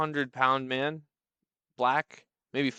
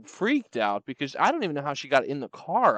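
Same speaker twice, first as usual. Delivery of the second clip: usual speaking voice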